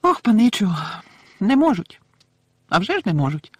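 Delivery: high, loud, squeaky little elf voice; earnest little house-elf piping up; Dobby the house-elf, earnest. Acted. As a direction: pitch it up into an eager elf squeal.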